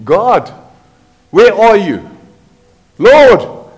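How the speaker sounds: rising plea that peaks in a loud call of Lord; anguished call for the Lord; desperate cry to God. Acted. Voicing desperate prayer.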